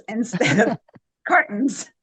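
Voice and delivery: laughing through the words; amused, laughing. Real, not acted. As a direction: break into a real chuckle mid-phrase. Amused listing of old-fashioned quirks.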